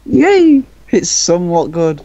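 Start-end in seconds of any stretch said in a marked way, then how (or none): 0.1-0.6 high, loud cheer of joy; a burst of delighted cheering; excited cheer. Real, not acted. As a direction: shout it out, thrilled. Cheering the story's verdict.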